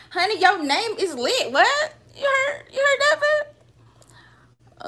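high, loud excited shriek; excited squeal. Real, not acted. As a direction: squeal it with delight. Reacting to a viewer's name.

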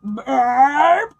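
big loud belch; the dragon burps everyone out. Acted. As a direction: let out a huge burp.

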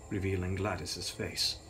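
ordinary delivery

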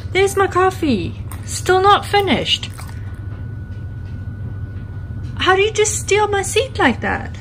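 pet talk. Real, not acted. Owner scolding pig in pet talk.

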